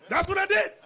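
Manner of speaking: very high, loud cry; preacher proudly telling his reply; exclaiming. Real, not acted. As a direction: crow it out.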